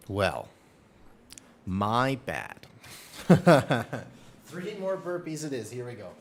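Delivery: ordinary delivery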